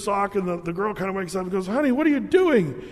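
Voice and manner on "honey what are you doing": sleepy wife voice. Acted. Wife wakes up in joke.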